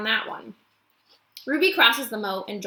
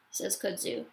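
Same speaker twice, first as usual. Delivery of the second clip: usual speaking voice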